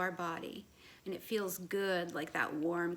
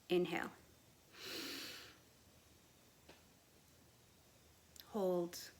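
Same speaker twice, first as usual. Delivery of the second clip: soft, sparse breathing cues, long pauses between; guided breathing cues; 7 dB quieter than usual. Real, not acted. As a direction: ease each cue down softer, ending almost whispered. Leads a breathing exercise.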